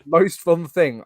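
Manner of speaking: ordinary talk, nothing marked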